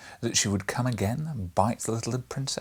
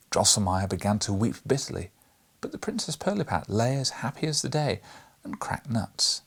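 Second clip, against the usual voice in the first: ordinary delivery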